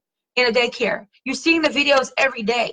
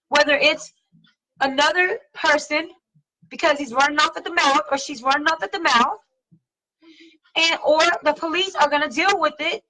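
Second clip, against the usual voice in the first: usual speaking voice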